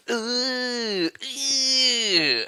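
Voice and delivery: long, high, held mock whine; pained "ooh". Customer reacting to the monthly price, mock pained "ooh". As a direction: wince out a drawn-out high whine. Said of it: "then I literally go".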